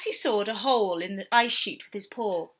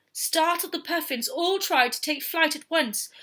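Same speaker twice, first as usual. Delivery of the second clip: ordinary delivery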